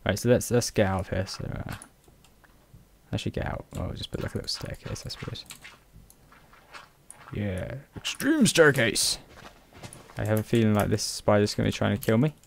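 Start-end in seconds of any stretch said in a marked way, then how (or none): 3.1-5.4 whispered muttering. Real, not acted. Muttering while playing. 7.3-9.2 slow mock-epic. Callback to extreme.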